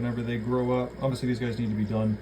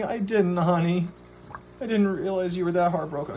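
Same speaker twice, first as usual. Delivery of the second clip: cooing reassurance; 7 semitones higher than usual. Real, not acted. Owner reassuring her heartbroken fish, in higher cooing talk. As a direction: soothe the fish's hurt feelings.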